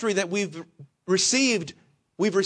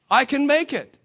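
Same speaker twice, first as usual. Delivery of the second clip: higher-pitched, lightly mocked confidence; confident insistence; 6 semitones higher than usual. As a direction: act out mild overconfidence, pitched up. Overconfident driver judging the gas gauge.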